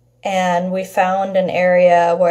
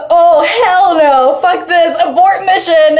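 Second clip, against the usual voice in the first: panicked bail; 8 semitones higher, 4 dB louder than usual. Retold or imitated. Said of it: "So Jesse goes".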